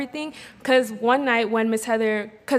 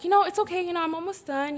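high, softening reassurance; lightly acted plea to stay; imitated coaxing; 7 semitones higher, 3 dB quieter than usual. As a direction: coax gently with the pitch up.

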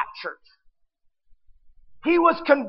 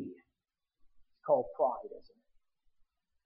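slow, much softer, almost breathed; hushed reveal; 11 semitones lower, 12 dB quieter, much slower than usual. Acted. Preacher revealing the sin to the congregation, slow hush. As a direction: drop it low and slow to land the word.

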